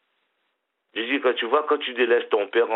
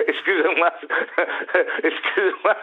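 real laughter, apologizing between laughs; apologizing while cracking up; laughing apology; 6 semitones higher than usual. Real, not acted. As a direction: laugh it off, sorry, can't stop. Caller laughs after accent compliment.

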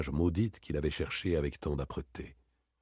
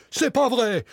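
high, loud frantic denial; panicked denial; 7 dB louder than usual. Acted. Varin, accused of murder, cries out in denial. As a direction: deny it in panic, voice cracking upward.

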